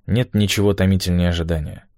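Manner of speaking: ordinary delivery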